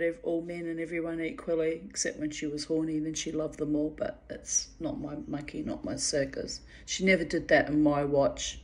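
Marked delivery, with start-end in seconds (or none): none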